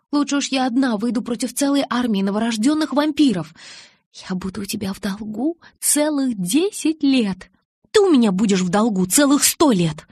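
a touch of dramatic insistence; heated bargaining. A narrator's reading. Alice bargains heatedly over wedding.

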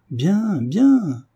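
high, swooping double cry; delighted exclamation. A narrator's reading. Tutor applauding his brave pupil, exclaiming high. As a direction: cheer him on, pitch soaring on each word. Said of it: "s'écria David à son tour".

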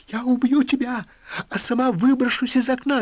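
high, loud frantic threats; frantic shouting hostage taker. A narrator's reading. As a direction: shriek threats in blind panic. Wife threatening, hysterical.

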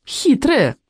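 high, playful accusation; mock-scold mother for being sly; teasing accusation. A narrator's reading. Daughter teases mother, wagging finger.

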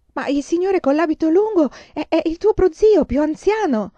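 thrilled to spot family; joyful recognition. Acted. Mother recognizing her uncle. (gioia nel riconoscerlo, molto più acuta)

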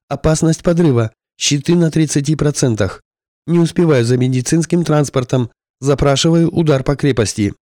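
pilot calling for a strike under fire; pilot over radio. A narrator's reading. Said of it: "радиосвязь зазвучала голосом пилота".

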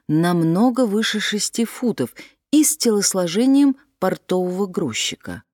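ordinary delivery

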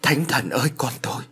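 understated horror; panicked mother. A narrator's reading. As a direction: wail mildly, then let the voice fall. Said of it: "Sự hốt hoảng của bà giáo lên tới mức tột độ".

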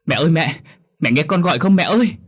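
high, loud anguished cry to mother; desperate calling. Acted. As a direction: call out frantically, pleading for an answer. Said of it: "Vừa gọi". (kêu gọi mẹ thảm thiết, giọng cao)

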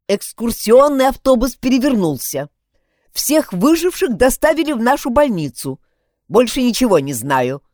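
loud, each word hammered out; clipped and curt. Acted. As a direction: rap out the facts coldly. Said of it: "отчеканила женщина".

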